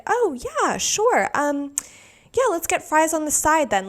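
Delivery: high, eager people-pleasing voice; mock meek agreement. Caught off guard ordering, high nervous agreeable voice. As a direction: squeak out a timid "sure".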